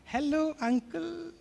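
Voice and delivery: lightly voiced small child, slow and soft; a hint of a little kid answering the phone slowly; three-year-old child. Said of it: "तीन साल का". (बच्चे की हल्की सी नकल, धीमे और नरम)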